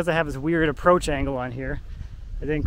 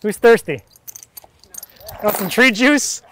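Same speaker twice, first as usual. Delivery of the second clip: loud, playful game-show offer; playful teasing; 5 semitones higher, 6 dB louder than usual. Acted. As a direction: hawk the tree juice like a vendor. Joking about log leaking water.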